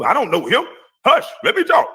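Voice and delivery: high, dismissive mock of a bully; mocking imitation. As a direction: toss it off high and haughty like he did.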